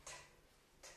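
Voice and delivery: "t" as a bare voiceless tap, no vowel; tiny separate "t" puffs; isolated letter sound. Shown as a demonstration. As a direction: make only the sound of "t", twice. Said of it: "T qui fait le son t".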